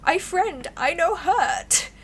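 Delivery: high, slow little-critter voice; possum voice. Acted. Possum reassuring the player in a high critter voice. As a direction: speak as a timid possum.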